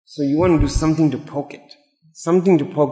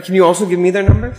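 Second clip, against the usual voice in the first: higher, louder and fast; mock eager request; 5 semitones higher, 4 dB louder, faster than usual. People begging for the magician's number, in a higher, louder, quick mock-eager voice.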